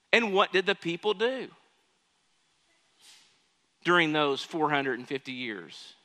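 ordinary delivery

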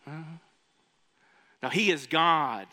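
normal talking voice

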